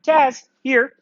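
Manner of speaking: high, loud recall; name then a loud high here; calling the dog. Real, not acted. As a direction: call her in from a distance.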